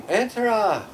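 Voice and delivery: high, loud call from behind the door; a sharp high shout of "Entra!". Retold or imitated. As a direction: yell it through the door. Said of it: "I heard someone scream".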